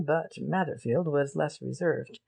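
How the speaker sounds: usual speaking voice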